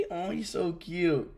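soft, low fond murmur; tender cooing. Real, not acted. Streamer telling chat the cat is so cute, tender. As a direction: melt over it, softly and low.

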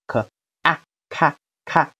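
teacher spelling out the stressed syllable 咔 in separate sounds; 可 and 啊 said apart, joined into 咔; phonics sounding-out. Shown as a demonstration. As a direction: sound out 可、啊、咔 one by one, then blended. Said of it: "重音读作".

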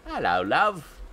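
hammed-up cockney "Hello, love"; lightly put-on London accent on "Hello, love"; cheeky cockney greeting; mock British greeting. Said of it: "that's the worst".

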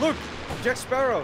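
excited shout. Real, not acted. Excited callout.

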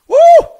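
loud, high-pitched "woo"; exultant whoop. Real, not acted. Preacher overcome by the revelation, loud whoop. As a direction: let out a sudden preacher's whoop.

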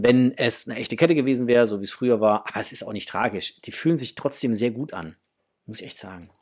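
ordinary delivery

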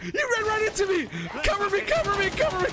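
high, urgent shouts to teammates; real mid-game alarm; panicked calling out. Real, not acted. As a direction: yell for cover under attack. Calling teammates under attack.

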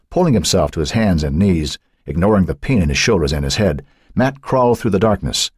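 plain reading voice

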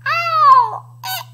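loud, high pained howl; garbled, hammed-up dinosaur yelp; Tyrannosaurus with tongue held. A narrator's reading. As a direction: wail as if your tongue is held fast. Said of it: "roared a new roar, and he said".

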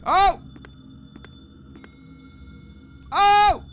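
loud high cry of fright; sudden real fright, a loud yelp; startled yelp. Real, not acted. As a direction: jump at the scare. Jump scare in horror game.

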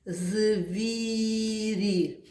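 Звірі drawn out sound by sound; stretched sounding-out. Shown as a demonstration. Teacher sounding out a word for children. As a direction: lengthen Звірі to let the vowels be heard. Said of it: "назвемо слово".

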